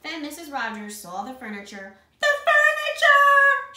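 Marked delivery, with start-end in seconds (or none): 2.2-3.7 very high, drawn-out shriek; horrified cry. A narrator's reading. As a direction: cry out in hammed-up horror. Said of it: "she cried".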